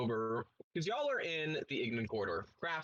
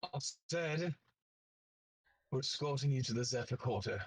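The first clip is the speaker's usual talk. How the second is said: poison in his voice. Acted. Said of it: "he has poison in his voice".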